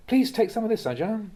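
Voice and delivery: sing-song coaxing offer, mock polite; eager food offering. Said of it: "When you hear the invitation".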